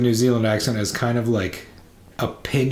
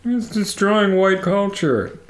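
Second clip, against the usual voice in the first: high, slow mock outrage; mock bigot voice; 10 semitones higher, slower than usual. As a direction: parody an online bigot. Mocking crazy people online.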